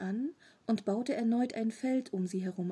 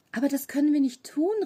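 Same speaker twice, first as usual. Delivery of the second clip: higher, louder, fast indignant protest; outraged cry; 5 semitones higher, 8 dB louder, faster than usual. A narrator's reading. Tom protests to Bollock, loud and outraged. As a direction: burst out in outrage. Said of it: "rief Tom empört".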